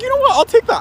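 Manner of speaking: high, quick agreement; eager acceptance. Retold or imitated. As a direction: grab the offer happily. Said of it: "I was like".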